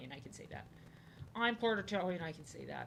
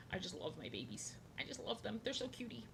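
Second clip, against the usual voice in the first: soft cooing; 2 semitones higher, 11 dB quieter than usual. Real, not acted. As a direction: coo to the babies. Cooing over pet alligators.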